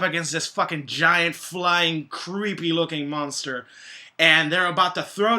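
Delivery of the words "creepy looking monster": ordinary talk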